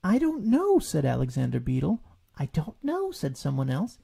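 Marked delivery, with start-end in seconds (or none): 0.0-0.8 high little beetle voice; small beetle. A narrator's reading. Alexander Beetle answers Rabbit, high and small. 2.4-3.1 high, unsure helper; another small voice. A narrator's reading. A relation answers Rabbit, high and unsure. Someone else.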